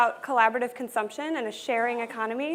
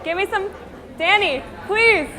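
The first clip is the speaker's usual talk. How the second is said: loud, higher pleading call with a laugh; pleading shout; 6 semitones higher, 6 dB louder, slower than usual. Real, not acted. Speaker begs DJ Danny for the music, high, loud and playful. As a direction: beg the DJ loudly and playfully.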